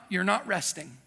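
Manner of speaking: usual speaking voice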